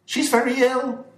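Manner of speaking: high, loud reproach to her master; indignant cry. Acted. Dolores rebuking her master about her sick mistress, indignant. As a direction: throw it at him with indignation. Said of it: "cried the girl".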